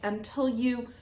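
normal talking voice

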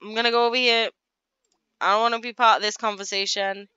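dad fleeing the awkward talk, loud and hurried; flustered dad voice. Acted. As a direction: back away fast, embarrassed. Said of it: "He's like".

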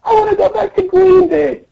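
very high tiny-princess wail; hammed-up sobby whine; mock crying. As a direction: bawl and plead in a squeaky cartoon voice. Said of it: "And then still crying".

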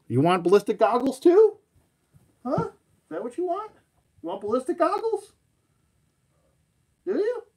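high, sing-song pet voice. Real, not acted. Asking the dog about goggles in high baby talk. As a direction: coo up high like talking to a puppy.

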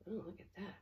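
ordinary delivery, nothing marked

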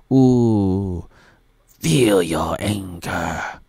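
slow, drawn-out sinister Emperor voice; Palpatine impression. Vlogger imitating the Emperor urging on anger, slow and sinister. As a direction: savour each word like the Dark Lord tempting you. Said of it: "says Darth, uh, Plagueis, not Plagueis, no, uh, Palpatine".

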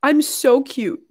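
mock-vain voice; vain self-admiration. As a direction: gush at your own reflection. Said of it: "I was like".

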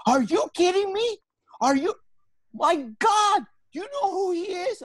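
astonished disbelief. Retold or imitated. Mitzi to him about Roger Ailes, shrieking amazement. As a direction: gush in wild disbelief, way up high.

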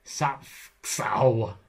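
game stab at Sapksau, both syllables drawn out; attempted Egyptian pronunciation. Shown as a demonstration. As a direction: have a go at foreign Sapksau, hard consonant cluster in the middle. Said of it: "attempt to pronounce these".

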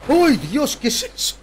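sudden high startled cry; startled scream. Real, not acted. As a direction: jump out of your skin. Sobresalto con algo en el juego. (grito de susto)

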